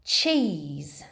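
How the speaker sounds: usual speaking voice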